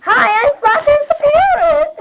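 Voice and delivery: a high, loud squawky bird; parrot voice. Acted. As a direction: chirp it out like a bright parrot. Introduces parrot character.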